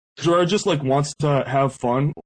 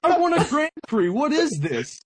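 high-pitched, hammed-up outrage; mock indignation; 11 semitones higher than usual. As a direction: imitate an affronted friend demanding recognition. Friend's retold jealous outrage.

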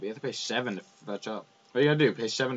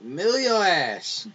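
slow, drawn-out gleeful shout; loud taunt; 8 semitones higher, 8 dB louder, much slower than usual. Acted. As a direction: stretch it out like a battle cry.

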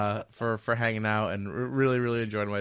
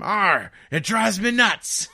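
pirate voice; 9 semitones higher, 8 dB louder, slower than usual. Acted. Pirate punchline in joke.

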